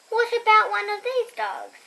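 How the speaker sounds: ordinary delivery, nothing marked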